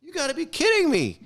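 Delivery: stunned high-pitched disbelief; incredulous. Retold or imitated. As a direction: throw your voice up in shock. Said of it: "I'm like".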